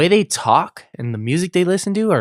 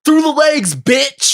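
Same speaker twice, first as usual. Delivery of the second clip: shouted much higher and louder; mock coach shout; 7 dB louder, much slower than usual. Coach shouting at a kid who fumbled the ball. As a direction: yell it across the field at the kid. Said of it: "I'm just like".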